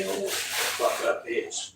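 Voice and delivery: a hushed, voiceless curse under the breath; muttered curse. Real, not acted. Cursing the cop under her breath, a hushed whisper. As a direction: spit it out barely audible.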